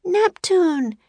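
high summoning call; Annie shouting. A narrator's reading. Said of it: "shouted Annie".

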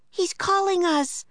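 put-on high-pitched girl's call; Annie shouting. A narrator's reading. Annie hearing the dog bark, high shout. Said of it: "shouted Annie".

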